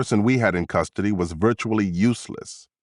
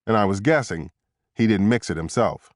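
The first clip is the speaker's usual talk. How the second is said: usual speaking voice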